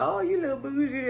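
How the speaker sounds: teasing. Retold or imitated. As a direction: imitate friends ribbing her. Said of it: "laughing and, and teasing her".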